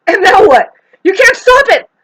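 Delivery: high, very loud challenge; shouted taunt. Acted. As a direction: yell it as a defiant taunt. Acted taunt after outburst.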